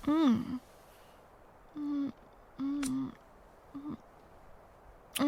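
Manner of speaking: quiet kissing hums; soft kissing murmurs. Acted. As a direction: murmur softly while kissing. Kissing sounds, softly.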